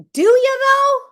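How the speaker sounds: loud, very high-pitched challenge; high, loud sceptical question; incredulous challenge. Real, not acted. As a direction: throw it back in sheer disbelief. Challenges the acted accusation.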